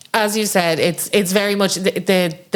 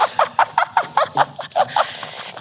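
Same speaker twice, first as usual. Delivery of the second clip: high breathless wail. Retold or imitated. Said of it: "Hysterically crying".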